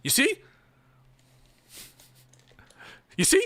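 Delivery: high, fast pompous impression; lightly voiced bragging boss; Rob Noir impression. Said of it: "That's my Rob Noir impression".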